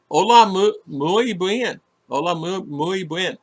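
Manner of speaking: high-pitched go at "Hola, muy bien", breaking off at "mu-"; halting Spanish attempt. Real, not acted. Speaker trying a Spanish phrase they can't say fast, halting. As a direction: stumble on "muy", restarting it before "bien". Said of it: "I can't say that fast anymore".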